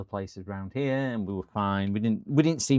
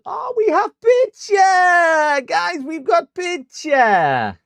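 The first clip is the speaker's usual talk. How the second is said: high, very loud, drawn-out yell of joy; stretched-out celebratory shout, far above usual pitch; triumphant cheer; 13 dB louder, much slower than usual. Pretend. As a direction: holler it out slowly like a victory chant. Celebrates the repaired screen showing picture.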